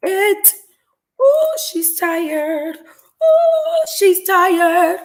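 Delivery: playful high-pitched tease about being worn out; mock sassy voice. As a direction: squeal it like a sassy friend teasing her. Mocking her own exhaustion.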